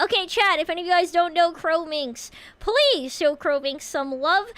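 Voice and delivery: ordinary talk, nothing marked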